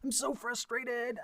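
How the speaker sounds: high, slow mock frustration; a hint of a fed-up party in mediation; imitating an angry client. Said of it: "they'll be like".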